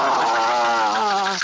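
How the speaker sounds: loud angry yell; mad yell. Shown as a demonstration. Leader demos a mad yell for the group, loud. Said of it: "I want you to yell about it".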